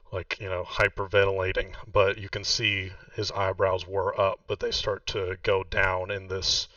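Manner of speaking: ordinary talk, nothing marked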